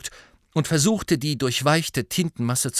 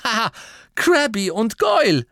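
high, loud laughing exclamation; triumphant laugh; 12 semitones higher, 7 dB louder than usual. A narrator's reading. Harry solving the puzzle, laughing loudly.

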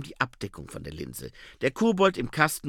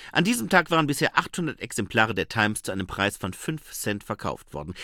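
ordinary delivery, nothing marked

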